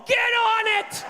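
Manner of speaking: high, slow, barked command; a boss ordering his staff; mock bossy order. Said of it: "Steve Jobs ordering".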